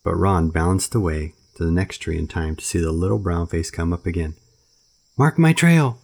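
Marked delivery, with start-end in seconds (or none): 5.2-6.0 high, loud shout across the treetops. Acted. Mowgli calling up to Ron while swung away, high loud shout. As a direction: call it out high and loud as you're carried off. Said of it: "Mowgli shouted".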